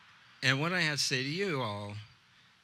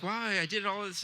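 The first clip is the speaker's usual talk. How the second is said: put-on shy girl; 7 semitones higher than usual. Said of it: "She's like".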